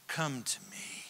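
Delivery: fearful whisper. Retold or imitated. David's fearful question whispered.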